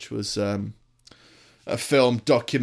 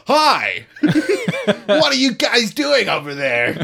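a hint of a hammed-up cheery American, higher and laughing; mock American dub; 10 semitones higher, 4 dB louder, slower than usual. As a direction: lightly send up a dubbed voice, giggling.

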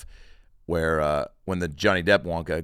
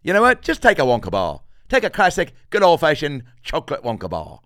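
loud, higher hearty salesman voice; generous shopkeeper; 6 semitones higher, 10 dB louder than usual. Retold or imitated. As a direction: hand it over with big cheer. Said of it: "the guy goes".

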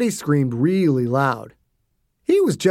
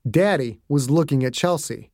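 usual speaking voice

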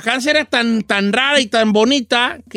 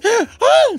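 grito de susto; 11 semitones higher than usual. Retold or imitated. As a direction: scream like the startled lady of the house. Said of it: "Se asusta". (grito agudo de señora asustada)

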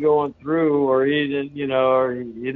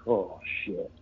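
low mutter; 6 semitones lower, 10 dB quieter than usual. Retold or imitated. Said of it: "Eddie's typical response".

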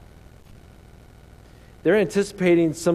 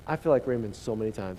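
normal talking voice

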